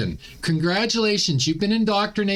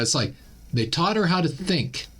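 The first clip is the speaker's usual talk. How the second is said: normal talking voice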